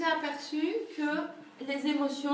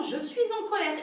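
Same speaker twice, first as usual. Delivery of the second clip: acted anger; 7 semitones higher, 3 dB louder than usual. Shown as a demonstration. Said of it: "on peut même le mimer".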